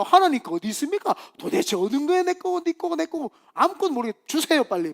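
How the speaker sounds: hammed-up impatient petition; demanding prayer. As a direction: badger God as if owed an answer. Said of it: "기도할 때도".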